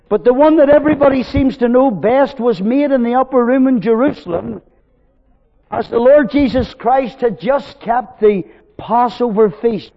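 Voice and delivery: loud, high, ringing preaching; preaching at full force. Acted. Preacher pressing his reasons on the congregation, full force. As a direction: drive each reason home to the hall.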